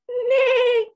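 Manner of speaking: high, loud "Nay" like a neighing horse; whinny the word like a pony; playful horse neigh. Pretend. Horse in picture book.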